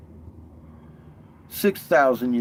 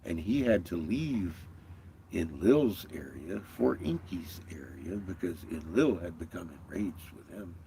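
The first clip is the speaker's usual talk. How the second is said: hushed; 4 semitones lower, 7 dB quieter than usual. Real, not acted. Hushed telling.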